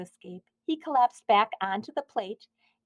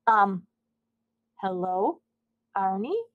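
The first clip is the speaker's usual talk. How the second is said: stammered; slower than usual. A narrator's reading. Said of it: "Mister Bing stammered".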